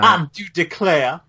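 mocking the game's hardcore Southern accent; mock Southern belle accent. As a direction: tease it in an over-the-top Southern accent. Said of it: "that hardcore Southern accent".

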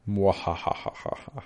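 slow mock villain cackle; evil laugh. Gloating evil laugh at the warning.

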